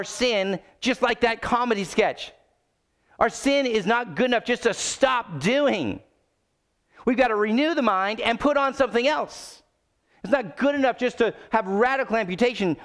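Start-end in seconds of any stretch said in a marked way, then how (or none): none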